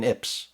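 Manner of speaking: ips said as one syllable rhyming with "lips"; acronym as word. Shown as a demonstration. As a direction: pronounce ips as a word, not I P S. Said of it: "nobody calls it".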